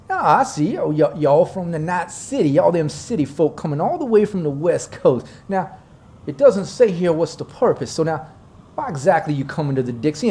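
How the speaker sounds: nosy Southern gentleman's drawl; Dixie drawl. Acted. Local boss questioning city folk about their purpose, Southern drawl. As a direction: draw out the suspicion in a Dixie lilt. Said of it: "my southern drawl got a little bit of that Dixie charm".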